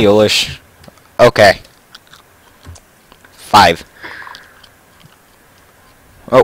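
usual speaking voice

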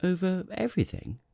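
put-on high, wondering child-prince echo; amazed little prince. Little prince questions the king's claim, amazed. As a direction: echo it in high-pitched disbelief.

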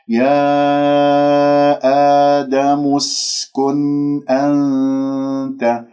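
very slow, sustained recitation; demonstrated tajweed recitation. Shown as a demonstration. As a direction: stretch each long vowel and hum the noon. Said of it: "لاحظتم كيف؟". (ترتيل تعليمي بطيء)

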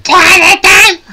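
high squealing cartoon cry, laughing; Toadette cry. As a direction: squeal it like a tiny cartoon mushroom. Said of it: "hasta grita como la Toadette".

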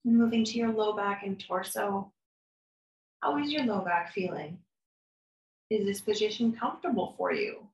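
calm, soft guided meditation voice, unhurried with long pauses; guided body scan. Acted. Therapist guiding a listener through a body scan, calm and soft. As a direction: lead them gently through the body.

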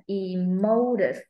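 "emotive" very slow with a long MO; second-syllable stress. Shown as a demonstration. Teacher offering stress options to a student, slow MO-stressed "emotive". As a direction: lean on e-MOOO-tive. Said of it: "stress vào âm mấy".

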